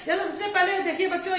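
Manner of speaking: ordinary delivery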